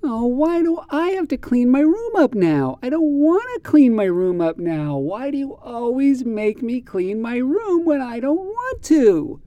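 put-on high, pleading child's whine; whining child. Shown as a demonstration. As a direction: whine like a kid who won't clean up. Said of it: "Here is an example of whining".

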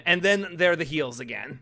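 usual speaking voice

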